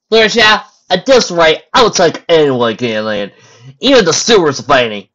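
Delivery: loud, emphatic character voice; Applejack. Acted. Applejack answering Fluttershy, loud and emphatic. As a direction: say it loud, starting high.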